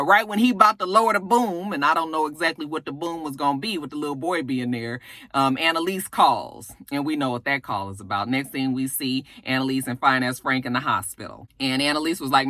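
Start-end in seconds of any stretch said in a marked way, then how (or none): none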